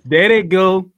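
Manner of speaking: loud shout of there they go; excited cheer. Real, not acted. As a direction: cheer them on loudly. Cheering a wrestling clip.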